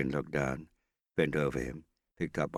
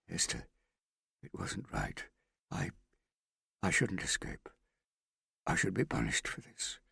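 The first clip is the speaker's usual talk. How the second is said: broken confession. Acted.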